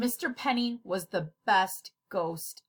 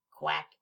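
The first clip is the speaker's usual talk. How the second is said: quack; 7 semitones lower than usual. Acted. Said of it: "One of the things should work".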